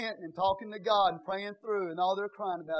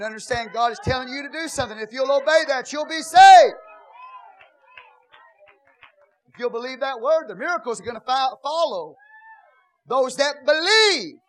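shouted, higher, much louder sermon; full-force preaching; 9 semitones higher, 16 dB louder than usual. Acted. Pastor exhorting the congregation, shouted full-force preaching. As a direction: preach it hard to the church.

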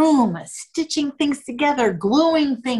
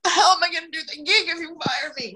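whiny protest; 6 semitones higher, faster than usual. Retold or imitated. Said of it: "I was like".

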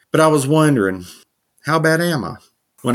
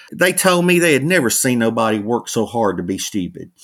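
ordinary talk

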